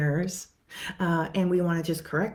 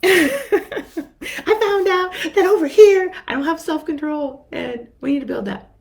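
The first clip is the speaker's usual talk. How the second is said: much higher and louder, laughing; excited discovery; 11 semitones higher, 10 dB louder than usual. Retold or imitated. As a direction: blurt the discovery with glee. Client excitedly reporting discovery.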